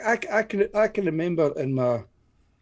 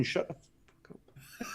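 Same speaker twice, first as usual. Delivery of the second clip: slow, breathy whispered jab at a friend; mock whisper; 4 semitones higher, 12 dB quieter, much slower than usual. Streamer whispers a joke insult at cohost Stephen, slow whisper. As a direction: hiss it slowly under your breath, teasing. Jokey reply to being greeted.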